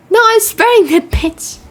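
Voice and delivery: high, loud, defiant refusal; defiant shout. Real, not acted. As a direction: yell it back in defiance.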